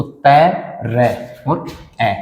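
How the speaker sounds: तै रै spelled out very slowly; slow breakdown. Shown as a demonstration. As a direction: sound out the parts of त्र one by one. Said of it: "फिर त्रय की बात करें".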